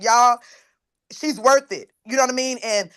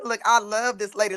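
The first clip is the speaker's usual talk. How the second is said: normal talking voice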